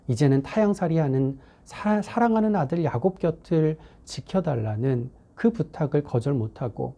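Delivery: ordinary delivery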